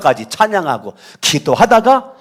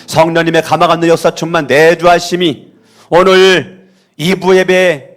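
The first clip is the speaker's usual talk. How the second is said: solemn, sustained pastoral blessing; benediction; 2 semitones higher, 6 dB louder than usual. Real, not acted. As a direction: pronounce the closing blessing, ending on a long amen. Pronounces closing benediction. (엄숙한 축도)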